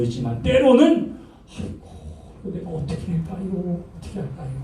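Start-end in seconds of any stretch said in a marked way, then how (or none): 1.6-4.5 soft, breathy, hopeless fretting; despairing murmur. Pretend. Preacher voicing a timid believer fretting under his breath. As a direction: shrink away from the task in a hush.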